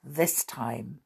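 plain reading voice

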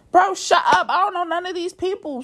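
high-pitched, indignant comeback; exasperated retort. Retold or imitated. Woman answers the man calling her rude, exasperated retort. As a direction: jump up in pitch and protest. Snapping back at toxic one's complaint.

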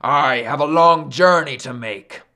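loud, put-on imperious voice; commanding. Sun insists he must travel, loud and commanding. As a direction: insist like a mighty god held up. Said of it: "Sun commanded".

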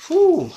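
loud high emotional whew exhale. Real, not acted. Vlogger moved by the case lets out a loud whew. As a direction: blow out a big shaken whew.

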